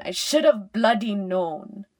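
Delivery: loud burst of self-reproach; frustrated outburst. A narrator's reading. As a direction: burst out loudly, angry at yourself. Self-reproach escalates after repeated thought.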